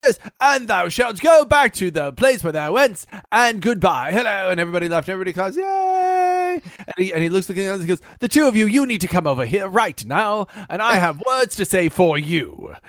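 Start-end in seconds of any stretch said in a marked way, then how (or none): 0.0-4.5 put-on theatrical Renaissance fair accent, pitched up; stagey fair performer's accent; showman's put-on accent. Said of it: "accent and doing everything". 5.6-6.6 high, drawn-out mock cheer; thin, high imitation of cheering; crowd cheer. 8.2-12.8 put-on grand theatrical accent, pitched up; imperious Renaissance performer's accent; showman's put-on accent. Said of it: "he drops the accent".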